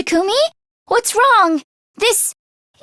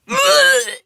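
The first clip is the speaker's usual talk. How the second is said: tearful laugh; 8 semitones higher than usual. Acted. Nervous confession.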